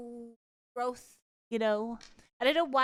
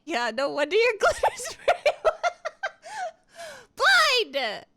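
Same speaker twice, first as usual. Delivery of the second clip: high, loud words through laughter; laughing, louder, pitched up; 12 semitones higher, 8 dB louder than usual. Real, not acted. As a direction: crack up mid-sentence. Laughing at reading a comment.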